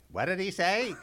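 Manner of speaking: put-on high, loud old person asking; mock confused old theatregoer. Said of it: "old people saying".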